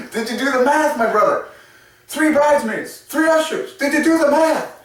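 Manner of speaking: high, mock-indignant wedding guest; mock outraged guy. Groomsman complaining to the couple, high mock outrage. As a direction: squeak it out like a baffled groomsman. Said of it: "What kind of wedding is this".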